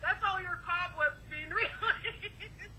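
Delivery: robot voice broken by laughter; can't hold the voice for laughing. Real, not acted. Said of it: "Like a lamb instead of a robot 'cause I'm laughing".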